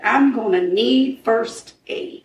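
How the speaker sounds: retold radio call, slow and deliberate; slowed-down repeat. Storyteller repeats officer's walkie-talkie call for ambulance, slowly and deliberately. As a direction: draw each word out evenly.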